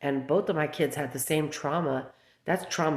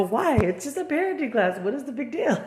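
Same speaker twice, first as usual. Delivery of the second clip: high-pitched puzzled retort; incredulous questioning; 10 semitones higher, 3 dB louder than usual. Retold or imitated. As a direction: re-enact a baffled challenge, pitched well up. Acted exchange about parenting class.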